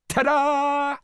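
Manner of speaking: very high, loud fanfare; squealed showy reveal; triumphant cry. A narrator's reading. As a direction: announce a big surprise, drawn out. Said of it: "cried the gingerbread man".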